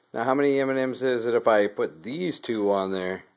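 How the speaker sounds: usual speaking voice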